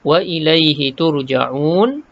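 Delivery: slow turja'un with long u; recited. Shown as a demonstration. Teacher reciting the verse ending. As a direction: stretch turja'un. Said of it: "turja'un jumpa lagi mat".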